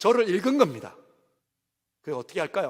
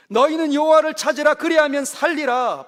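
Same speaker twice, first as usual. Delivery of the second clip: 너희는 여호와를 찾으라 repeated higher and louder, ringing out; loud proclaiming repeat; 7 semitones higher, 6 dB louder than usual. Shown as a demonstration. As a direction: call out the verse a second time with full force.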